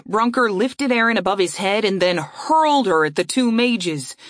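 ordinary delivery

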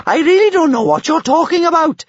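very high, loud blustering bellow. A narrator's reading. Bertha blusters her way out, bellowing. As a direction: bluster at full volume in a high voice. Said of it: "she bellowed".

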